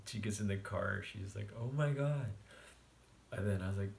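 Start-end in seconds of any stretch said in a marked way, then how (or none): 1.6-2.3 loud high "oh my God"; shocked. Retold or imitated. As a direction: react in disbelief. Friend's shocked reaction.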